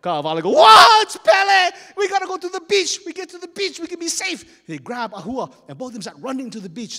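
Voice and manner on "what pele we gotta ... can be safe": high, very loud alarm; panicked yell. Acted. Terrified chief urging escape to the beach, panicked shouting. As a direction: scream it out, fleeing for your life. Chief flees from lava goddess.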